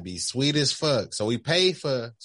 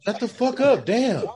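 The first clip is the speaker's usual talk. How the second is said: high, loud fed-up moviegoer; hammed-up exasperated shout; mock angry outburst; 12 semitones higher, 5 dB louder than usual. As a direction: snap at the talkers, pitched way up. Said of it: "one person there to get real mad".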